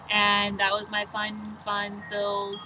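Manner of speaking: slow, dragged-out, awkward phrasing; a slow, stumbling first take; said oddly. Shown as a demonstration. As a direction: stretch the words out clumsily. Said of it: "'cause I said it odd".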